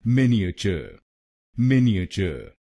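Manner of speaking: मिनिएचर as मिन-ई-अ-च, clearly joined; correct pronunciation. Shown as a demonstration. Teacher joins the syllables into the whole word, clearly. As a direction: model मिनिएचर syllable by syllable run together. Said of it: "तो बनेगा".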